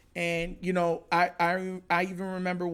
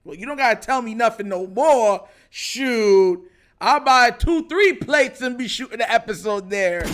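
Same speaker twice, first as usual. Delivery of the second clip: louder, higher, eager brag; hyped reply; 4 semitones higher, 8 dB louder than usual. Retold or imitated. Retelling his reply to Lamar's tweet, excited and louder. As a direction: pump it up like you can't wait to go. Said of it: "I'm like".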